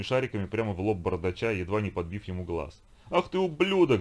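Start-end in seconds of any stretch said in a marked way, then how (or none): 3.1-4.0 намного выше и громче, с яростью; взреви от ярости; яростный рёв. Acted. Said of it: "взревел тот". (яростный рёв)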